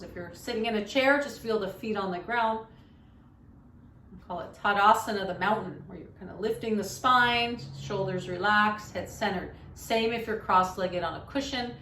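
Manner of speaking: soft-spoken yoga teacher leading a meditation; guided meditation. Shown as a demonstration. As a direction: guide listeners gently into stillness, quietly.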